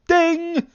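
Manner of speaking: high sung bell ding. Pretend. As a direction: sing it like a ringing bell. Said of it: "I'll ring this bell".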